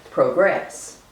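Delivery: "progress" as proh-GRESS, stress at the end; second-syllable stress. Shown as a demonstration. Lecturer comparing variants of the word, second-syllable stress. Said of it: "the second syllable".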